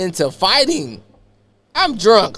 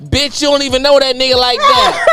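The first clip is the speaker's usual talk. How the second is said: laughing taunt; 8 semitones higher than usual. Retold or imitated. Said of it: "her cousin hanging out the window like this".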